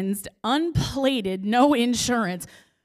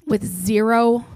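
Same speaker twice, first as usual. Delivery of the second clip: ordinary delivery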